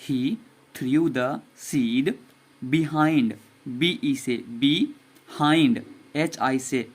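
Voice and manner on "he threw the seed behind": very slow, each word drawn out; slow model reading. Shown as a demonstration. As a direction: read it slowly for the class to repeat. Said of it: "फिर से पढ़िए". (बहुत धीरे-धीरे खींचकर पढ़ना)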